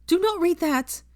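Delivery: high, urgent cry; desperate to stop him; panicked plea. A narrator's reading. Hermione begging Draco not to read the letter, panicked and high.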